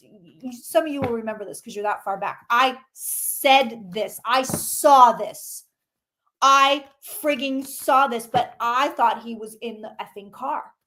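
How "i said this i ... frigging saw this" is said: slow, deliberate insistence; emphatic. Real, not acted. Psychic insisting to viewers she foresaw it, slow emphasis. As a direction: drive each word home. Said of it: "insists she predicted it".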